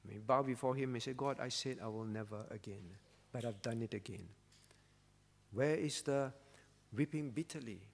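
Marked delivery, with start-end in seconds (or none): 1.1-4.3 mild hushed prayer of failure; ashamed confession. Pretend. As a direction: lower and softer, a quiet admission. Preacher voices a kneeling confession.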